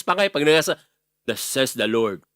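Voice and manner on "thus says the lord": low, booming prophet voice; big voice of God's prophet. Retold or imitated. Preacher mimicking the prophet's big voice, booming and low. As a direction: proclaim it like a prophet of the Lord. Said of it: "kailangan malaki yung boses".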